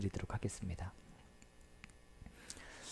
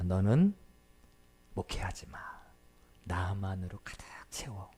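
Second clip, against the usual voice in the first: whispered divine words; 3 semitones lower, 4 dB quieter than usual. Retold or imitated. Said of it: "이게 핵심 포인트였던 거예요".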